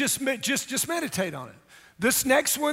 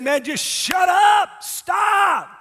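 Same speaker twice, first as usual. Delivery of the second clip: much higher, shouted, slow command; God's voice shouting; 10 semitones higher, 7 dB louder, much slower than usual. Acted. Pastor voicing God telling people to be still, shouting. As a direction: bellow it as a divine order. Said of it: "He said".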